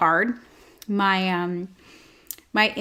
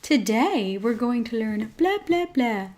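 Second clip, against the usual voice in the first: mock perky presenter; bubbly personality. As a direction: parody a bubbly host's singsong intro. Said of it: "those bubbly personalities".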